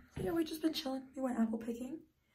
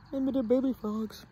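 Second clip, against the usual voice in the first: usual speaking voice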